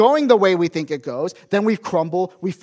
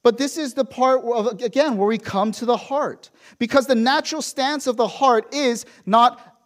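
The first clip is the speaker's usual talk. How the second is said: ordinary talk, nothing marked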